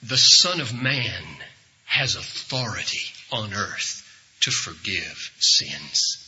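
slow, low, measured authority; very calm. Retold or imitated. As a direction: answer calmly, letting each phrase settle. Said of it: "He said very calmly".

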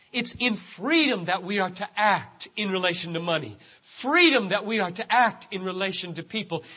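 ordinary talk, nothing marked

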